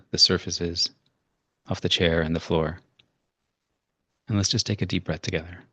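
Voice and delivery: low, unhurried meditation voice; calm, low-pitched breathing guidance; guided meditation. Shown as a demonstration. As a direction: gently guide listeners into settling down. Leads a short breathing meditation.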